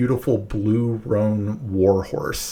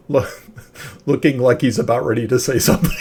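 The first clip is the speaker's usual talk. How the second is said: laughing while talking, fast and higher; real laughter; 4 semitones higher, 7 dB louder, much faster than usual. Real, not acted. Game master teasing a player at the wedding, laughing. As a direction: crack up mid-sentence, words rushing out.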